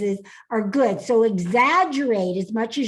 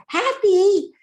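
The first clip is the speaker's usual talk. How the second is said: a bright, higher chirpy "Happy," said out of place; mock-cheerful "Happy," at a sad moment; mismatched cheery; 8 semitones higher than usual. Shown as a demonstration. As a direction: toss off "Happy," cheerfully where it doesn't fit. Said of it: "So you don't wanna be like".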